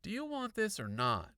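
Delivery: put-on high kid's voice, pressing; impatient boy. A narrator's reading. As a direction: press her for an answer. Boy pressing his offer.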